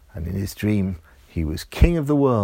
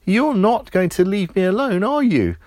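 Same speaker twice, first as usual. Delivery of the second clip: put-on higher child voice; resigned little boy; 8 semitones higher than usual. A narrator's reading. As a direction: ask the toothbrush, half giving in. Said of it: "Roger said".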